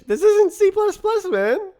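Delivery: squeaky high outburst; high-pitched exclamation. Real, not acted. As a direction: shoot way up high in exasperation.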